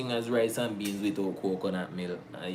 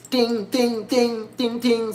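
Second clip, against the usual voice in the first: high plinking "ting" sounds; imitated instrument; 11 semitones higher, 4 dB louder, slower than usual. Showing the little riff in the song, high imitated plinks. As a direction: mimic the song's little tinkling riff. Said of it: "the song got, like, that little".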